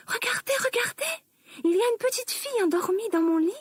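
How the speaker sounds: put-on very high, tiny baby bear crying out; a little bear calling out about the girl in his bed; baby bear's tiny cry. A narrator's reading. Baby bear spots the sleeping girl, tiny high cry. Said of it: "a crié bébé ours avec sa toute petite voix".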